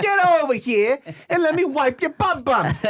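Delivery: high, slow playful dad voice; mock doting dad. Dad calling his kids over, high and slow and playful. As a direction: coo it like a silly dad.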